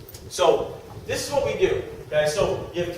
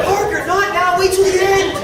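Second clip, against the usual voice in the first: high, loud laughing mock scold; laughing dad scolding; 11 semitones higher, 9 dB louder than usual. Dad telling son Parker to wait, laughing scold. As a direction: scold the son while cracking up.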